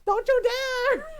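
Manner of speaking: high, loud, slow "Don't you dare"; mock shriek. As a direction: drag out a shrieking warning. Acting out mock threat.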